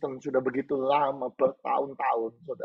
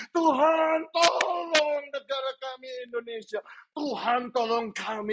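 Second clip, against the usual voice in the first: raised, urgent prayer calls; crying out; 11 semitones higher than usual. Real, not acted. Preacher leading prayer for church, city and nation, crying out. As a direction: call out each plea with a drawn-out "Tuhan". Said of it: "Mari saudara-saudara berseru".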